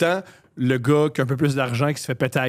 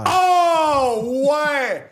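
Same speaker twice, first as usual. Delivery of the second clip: excited; 12 semitones higher, 4 dB louder than usual. Real, not acted. Sudden enthusiastic realization.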